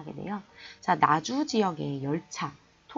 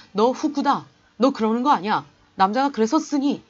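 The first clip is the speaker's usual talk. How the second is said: louder, higher stern scolding; scolding shout; 3 semitones higher, 7 dB louder than usual. Retold or imitated. Cousin scolds Fukuda for teasing the girls, loud scolding. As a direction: tell him off like a big brother.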